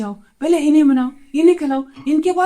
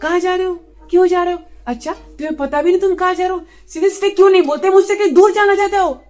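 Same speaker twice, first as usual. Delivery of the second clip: higher mock wife firing questions; interrogating wife; 4 semitones higher than usual. Man mimics Columbus's wife questioning his trip, mock interrogation. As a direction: mimic a wife's suspicious questioning, building to accusation. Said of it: "नहीं तो दस सवाल पूछती".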